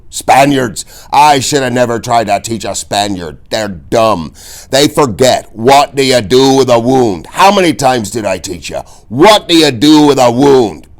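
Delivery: high, loud exasperated yelling; screaming teacher. A narrator's reading. Old fencing teacher yelling at Inigo, loud and high. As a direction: bark at the dumb pupil. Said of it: "McPherson was screaming again".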